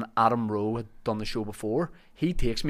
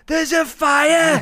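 slow, much higher, much louder alarm cry; hammed-up manager yelling the warning; mock panicked shout; 11 dB louder, much slower than usual. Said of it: "very calmly went".

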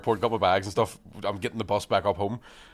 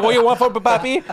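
high, loud mock attendant voice; Filipino flight attendant voice; 12 semitones higher, 7 dB louder than usual. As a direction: put on a high playful attendant voice. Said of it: "He's like".

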